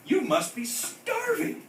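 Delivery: high, slow motherly voice; tender. A narrator's reading. Mama fussing over her son, high slow coo. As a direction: coo it to him. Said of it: "said Mama".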